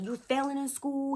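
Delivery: high-pitched reproach; imitated stepdad telling off a child; scolding. Stepfather scolds girl in story.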